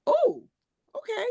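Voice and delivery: high, startled "oh" that settles into "okay"; a bright surprised oh, quickly accepting; mock surprise. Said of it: "I was like".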